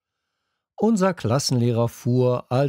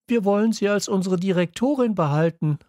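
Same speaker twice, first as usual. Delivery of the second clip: higher, fast, rushing it out; blurted out; 9 semitones higher, faster than usual. A narrator's reading. Woman blurting to the headmistress, fast and higher. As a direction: burst out before she can stop. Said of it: "platzte es aus Maggie heraus".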